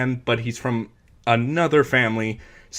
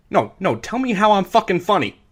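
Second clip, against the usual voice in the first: much higher, pressing threat; menacing Tommy; 8 semitones higher than usual. Retold or imitated. Tommy presses Henry in the restaurant, mock menace. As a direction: lean in and push him. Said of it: "It's like".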